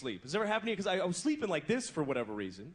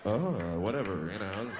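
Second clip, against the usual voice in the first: low half-hearted moan; bored moan; 11 semitones lower, slower than usual. Retold or imitated. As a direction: moan like you're killing time. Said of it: "holding pattern bullshit moan".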